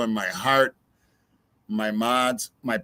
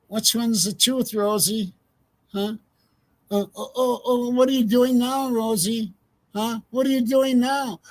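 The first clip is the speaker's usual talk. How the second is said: mocking higher-pitched taunt; taunting Rosie; 7 semitones higher than usual. Streamer taunting the officer about his changing story, mocking. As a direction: needle her with a sing-song jab.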